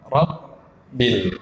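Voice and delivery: "Rabbil" with a silent stop between rab and bil; cut-off b. Shown as a demonstration. Teacher models the correct reading, cut-off b. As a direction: cut the sound dead on the first b of "Rabbil". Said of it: "Putus suaranya".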